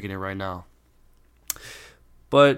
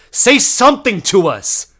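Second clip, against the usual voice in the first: high, slow, very loud yell; exasperated shout; 9 semitones higher, 11 dB louder, slower than usual. Retold or imitated. Exasperated repeat to the waiter.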